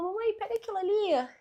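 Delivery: a small daughter asking mom for a toy; child's request. Retold or imitated. Daughter at the cupboard asks mom for a toy, lightly voiced child. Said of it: "abriu o armário e falou".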